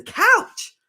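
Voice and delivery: high, loud cry of "Couch!"; shout. Real, not acted. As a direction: call him out in loud disbelief. Calling out a chat user.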